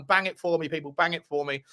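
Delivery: usual speaking voice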